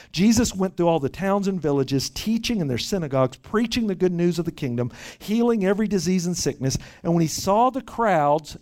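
ordinary delivery

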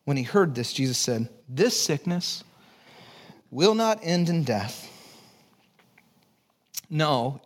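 usual speaking voice

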